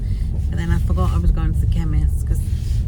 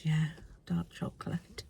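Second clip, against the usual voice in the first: whispered joke; 2 semitones lower, 14 dB quieter than usual. Retold or imitated. Quoting her joke to doctor.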